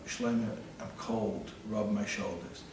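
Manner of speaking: quiet, slow, understated plea; retold whimper. Freezing friend begs Shlomo in the freezer, mildly whimpering. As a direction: softly imitate a freezing friend begging. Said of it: "Yossi's whimpering and he's crying".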